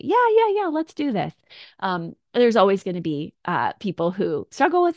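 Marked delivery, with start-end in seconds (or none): 0.0-1.3 high, loud, eager cheer; bouncy, all-in enthusiasm; eager yes. Retold or imitated. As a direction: cheer them on as if you can't wait. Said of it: "those people who are ... to say".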